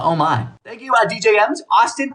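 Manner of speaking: usual speaking voice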